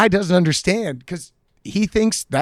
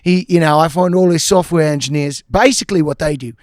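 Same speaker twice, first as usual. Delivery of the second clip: British braggart; 3 semitones higher, 4 dB louder than usual. Retold or imitated. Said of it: "So he goes".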